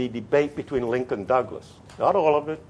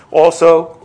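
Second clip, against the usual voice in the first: normal talking voice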